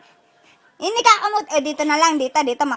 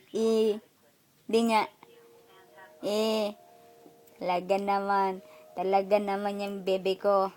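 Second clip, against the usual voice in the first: low, very soft fond murmur; 8 semitones lower, 9 dB quieter, slower than usual. Real, not acted. Parent talking to "bebe ko", softly and tenderly.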